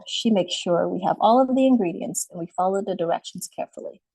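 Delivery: normal talking voice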